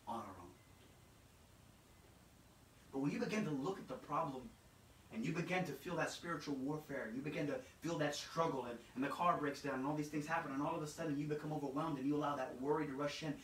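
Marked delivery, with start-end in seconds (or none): none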